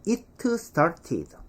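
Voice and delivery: "it started" as "it-tuh star-tee-duh"; every T and D released in "it started"; 한국식 발음. Shown as a demonstration. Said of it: "우리는". ('이트 스타티드'로 또박또박)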